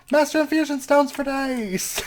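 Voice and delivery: high, slow, stretched-out cry of disbelieving glee; excited outburst. Real, not acted. Player stunned by duplicated stones, real excited outburst. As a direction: belt it out like you can't believe your luck. Mod bug floods the world with items.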